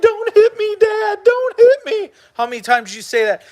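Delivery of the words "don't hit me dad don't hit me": high, whimpering plea to a father; a hammed-up falsetto plea to Dad not to hit; mock pleading child. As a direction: beg like a cowering kid in falsetto. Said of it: "you're like".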